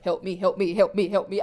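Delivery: low, repetitive chant of pleading; lightly acted muttered-mantra repetition; repeating over and over. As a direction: loop it evenly like a stuck record. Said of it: "repeating it over and over again".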